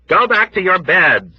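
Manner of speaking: high, loud pleading. Acted. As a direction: plead with them to return. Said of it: "Nurse Duckett pleaded".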